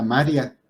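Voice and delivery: "María" said as MÁ-ria, two syllables; stress shifted to first syllable. Shown as a demonstration. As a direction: stress the first A and glide the "ia" together. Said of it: "no lo viéramos con el acento".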